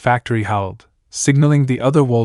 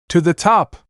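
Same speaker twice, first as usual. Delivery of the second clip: mild shout; 6 semitones higher than usual. A narrator's reading. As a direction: lightly shout a direction. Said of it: "Tigress shouted".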